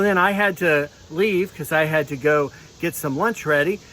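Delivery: ordinary delivery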